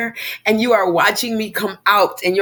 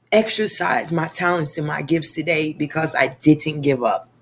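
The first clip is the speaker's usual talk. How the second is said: usual speaking voice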